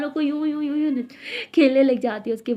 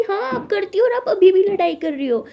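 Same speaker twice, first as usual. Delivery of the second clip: higher, louder heated retort; 8 semitones higher, 6 dB louder than usual. Acted. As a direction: fire it back. झगड़ा बढ़ता. (झगड़े में तेज़ आवाज़)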